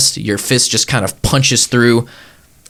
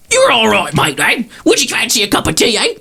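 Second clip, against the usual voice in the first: put-on much higher crotchety old woman's voice, Cockney-ish; cranky old crone yelling through the dust; tottery old lady; 11 semitones higher than usual. Shack's old lady shouting at the stranger at her door, high and cranky. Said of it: "this tottery old lady".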